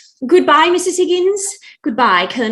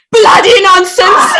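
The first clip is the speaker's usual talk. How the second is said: louder, higher, tossing out the swear word with a laugh; bold exclamation; 6 semitones higher, 7 dB louder than usual. Acted. Young lady to the room, laughing loud exclamation. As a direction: fling the shocking word out proudly.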